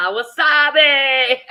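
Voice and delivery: loud, high, drawn-out holler; whooped long and loud; excited catchphrase. Retold or imitated. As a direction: stretch it out in excited celebration. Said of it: "I get really excited".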